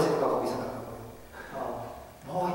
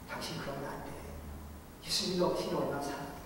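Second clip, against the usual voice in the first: much softer, pleading plea; tender plea; 3 semitones lower, 13 dB quieter, faster than usual. Retold or imitated. 눈 위에 무릎 꿇고 창 앞에서 호소하는 집사, soft plea. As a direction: plead gently through the window.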